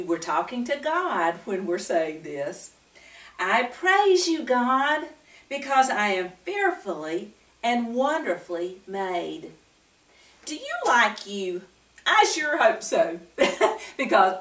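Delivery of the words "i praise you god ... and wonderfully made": high, slow "I praise you, God"; slow emphatic reread. Shown as a demonstration. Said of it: "Let me read it one more time".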